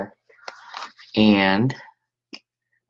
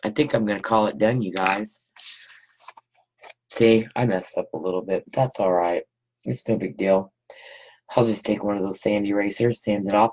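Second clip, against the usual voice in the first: ordinary talk, nothing marked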